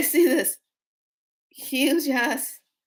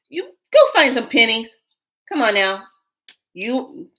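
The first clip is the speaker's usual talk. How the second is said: loud urging; 2 semitones higher, 7 dB louder than usual. Real, not acted. Pushing viewers to find pennies.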